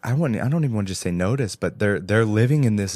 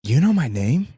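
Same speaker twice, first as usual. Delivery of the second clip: stunned question; 7 semitones higher, 4 dB louder than usual. Retold or imitated. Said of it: "she looks stunned".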